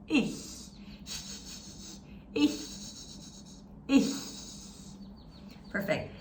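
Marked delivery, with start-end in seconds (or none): 0.1-4.1 ich with a soft breathy ch, no voice; German ich. Shown as a demonstration. Teacher repeating the soft ch for students. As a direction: hiss the ch of ich gently. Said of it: "exactly the sound that we need".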